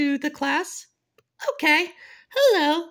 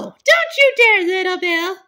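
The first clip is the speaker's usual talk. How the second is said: horrified shout; 2 semitones higher, 5 dB louder than usual. Pretend. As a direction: yell it to stop him. Bully threatens her lollipop.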